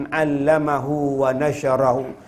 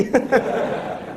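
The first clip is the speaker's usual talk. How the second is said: breathy unvoiced laugh; 6 semitones higher, 5 dB quieter than usual. Real, not acted. Laughing at widow joke.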